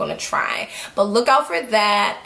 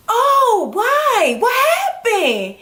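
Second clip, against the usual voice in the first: high, drawn-out mock wail; dismayed surprise; 11 semitones higher, much slower than usual. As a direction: imitate someone aghast at bad news. Boy reacts dismayed to her news.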